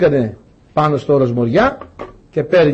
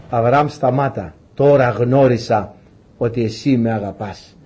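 lower, slow, solemn angel voice; angel's command; 5 semitones lower, slower than usual. Acted. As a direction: halt him gently but with authority, slowly. Angel stops Abraham.

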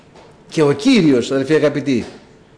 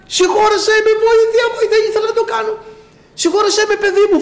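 crying and wailing. Retold or imitated. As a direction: cry out for help, begging, voice pitched way up. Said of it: "αρχίζει να κλαίει ο αδερφός και να ουρλιάζει".

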